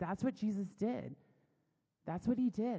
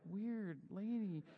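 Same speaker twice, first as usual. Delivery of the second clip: slow, softer mock aside; retold bemused aside; 7 dB quieter, much slower than usual. Husband reacting to crying wife, bemused aside.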